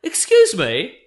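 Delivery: 2 high, slow, prissy complaint; 3 act as a timid reader, high and drawn out; 1 fussy letter writer. Retold or imitated. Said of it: "writing letters in ... being like".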